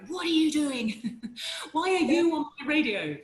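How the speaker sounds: loud, hammed-up northern English complaint; northern male callers. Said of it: "men ringing up going".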